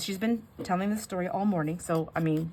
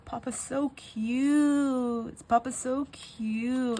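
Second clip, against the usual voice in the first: high, long-drawn "cute", cooed; toddler; 7 semitones higher, 3 dB louder, much slower than usual. Retold or imitated. Toddler adoring papa at hospice, imitated high cooing voice. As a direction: gush slowly like a doting little child. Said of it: "she kept saying".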